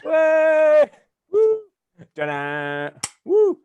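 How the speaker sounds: high, loud whoops; mock cheering. Friend cheers the elephant's fall, loud whoops. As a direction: whoop like a crowd at a stunt.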